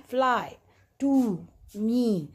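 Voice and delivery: फ्लाई, टू, मी, each final vowel held long; teacher drawing out फ्लाई, टू, मी one by one; elongated vowels. Shown as a demonstration. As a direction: slowly stretch the open endings of फ्लाई, टू and मी. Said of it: "sounds जो है elongated".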